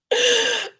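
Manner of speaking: high burst of laughter; shocked laugh. Real, not acted. Recalling the janitor, a high bursting laugh. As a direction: laugh out loud in disbelief.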